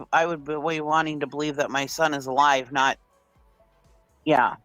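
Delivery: ordinary delivery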